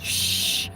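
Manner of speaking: long hissed shush; imitated shushing. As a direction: hush someone singing too early. Imitates Matt hushing her singing.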